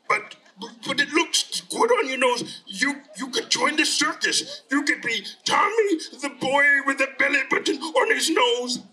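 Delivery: cartoon creature talking a boy round; coaxing monster. Monster coaxes Tommy.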